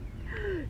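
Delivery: high, sharp shocked gasp. Acted. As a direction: gasp in horror. Old man sees ruined patch.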